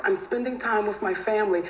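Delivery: usual speaking voice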